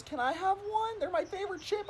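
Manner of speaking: put-on high, soft little-girl plea. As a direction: imitate a small kid asking for a treat. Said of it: "this little girl comes up to me".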